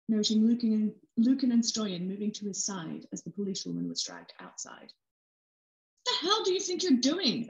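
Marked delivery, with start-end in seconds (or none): 4.9-7.5 much higher, slow, furious demand; angry demand. A narrator's reading. Officer confronting her partner outside, furious and high. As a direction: tear into him for dragging you out. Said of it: "Alicia demanded of Brody".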